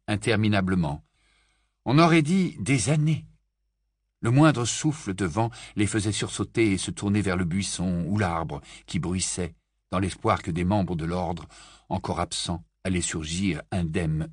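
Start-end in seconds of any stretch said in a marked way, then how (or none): none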